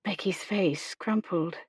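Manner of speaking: ordinary delivery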